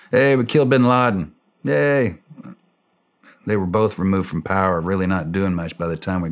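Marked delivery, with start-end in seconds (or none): 0.1-2.2 higher-pitched token cheer; a half-hearted little celebration; mock excitement. Veteran mimicking everyone celebrating the kill, mock excited. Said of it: "We're all excited".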